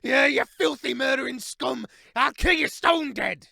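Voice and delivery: high, loud battle cry; furious yell. A narrator's reading. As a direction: scream it as you leap on the foe. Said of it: "yelling as he locked paw".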